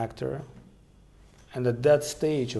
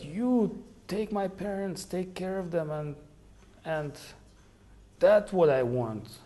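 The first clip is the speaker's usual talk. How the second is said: put-on dying boy's request; 6 semitones higher than usual. Dying sadhu's last request.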